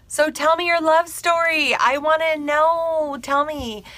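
high, drawn-out coaxing; eager pleading. Retold or imitated. Acts her eager request for story.

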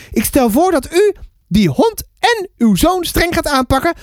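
indignant, high-pitched neighbour; mevrouw Banks. Acted. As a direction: lecture the mother in lofty outrage. Indignant neighbour lectures the mother. (hoge, verontwaardigde buurvrouwstem)

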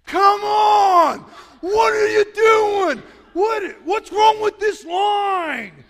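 shrill impatient rant at the gate staff, slow and very loud; freaking out. Retold or imitated. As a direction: scream at the ticket line like an impatient fan. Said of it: "The lady is freaking out behind me".

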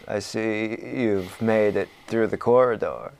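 low, quiet, sinister welcome; villain cat. Retold or imitated. Siamese cat boss greeting the intruders, low and sinister. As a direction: greet intruders with hushed, lofty menace.

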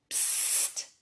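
breathy hushed psst; whisper. A narrator's reading. Scared hare hushing the hedgehog, whispered. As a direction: hush them in a whisper. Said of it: "wisperte da der Angsthase".

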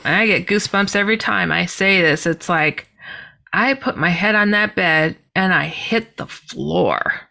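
ordinary delivery

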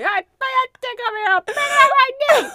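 high, fast, eerie far-off voice; haunt it from another room; ghostly voice. Retold or imitated. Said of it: "my ghostly voice from within the home".

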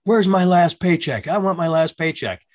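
high-pitched imitation of a yelling man; retold screaming. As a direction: act out an angry ex-employee demanding his paycheck. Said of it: "he is screaming at me".

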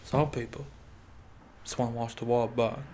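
quiet attempt at a British accent; soft British film-butler imitation; Michael Caine. Quoting a Batman film to the co-host, soft British accent. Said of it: "try to do a British accent".

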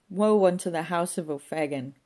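loud, theatrical lament; woeful cry. A narrator's reading. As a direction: proclaim your doom like a wailing prophet.

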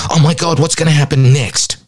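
high, fretful mock worry; mock panic. Explaining anxiety to the host with mock panicky worry. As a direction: fret about what comes next. Voicing anxious fear.

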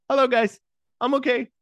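high, loud chirpy mock voice, way up; imitating Zoe popping up. Zoe jumping out of the weeds to reassure crying friends, high and loud. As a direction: squeal it out cheerfully like nothing happened. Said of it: "all of a sudden she's like".

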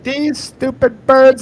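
high, loud, drawn-out mock rant; retold angry outburst. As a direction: imitate your own fuming at birds. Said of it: "I was so angry that day".

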